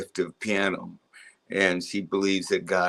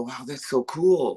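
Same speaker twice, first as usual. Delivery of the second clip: slow, slightly raised marveling quote; mild retold wonder; 4 semitones higher than usual. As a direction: lightly act out a child's amazement at his own playing. Acts out himself marveling as child.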